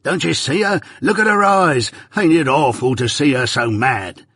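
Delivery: high, frantic cries of a man seeing a ghost; terrified crying out. Acted. Dying Arthur cries out to Compeyson's wife, terrified and high. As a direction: cry out in horror at the vision. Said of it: "he cries out".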